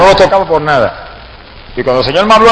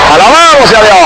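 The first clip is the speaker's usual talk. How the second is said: praise shout; 4 semitones higher, 4 dB louder than usual. Real, not acted. Preacher praising God mid-testimony.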